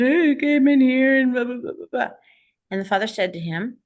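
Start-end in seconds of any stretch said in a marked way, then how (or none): 0.0-2.1 put-on higher, louder childlike wail; whiny little boy. Yanis answering his father, loud whiny child voice. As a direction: burst out like an upset kid.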